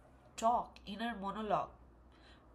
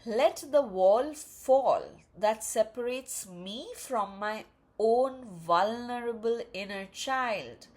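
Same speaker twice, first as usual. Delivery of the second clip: slow, louder, deliberate affirmation reading; affirmation read aloud; 9 dB louder, slower than usual. Acted. Reader voicing the self-love card to viewers, slow and emphatic. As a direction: proclaim each phrase of the card slowly.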